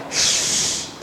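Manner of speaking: sh drawn out, spread breathy hiss; sh with air spreading everywhere; shin demo. Shown as a demonstration. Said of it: "huruf shin".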